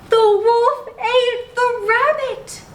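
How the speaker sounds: gasp, then high and slow in shock; dramatic gasp before the shock news. A narrator's reading. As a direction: tell the twist wide-eyed and aghast. Shocking twist told to child.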